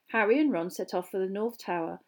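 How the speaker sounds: plain reading voice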